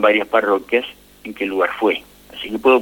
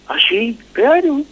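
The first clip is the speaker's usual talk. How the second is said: surprised; 12 semitones higher than usual. Retold or imitated. Boy surprised, priest affirms.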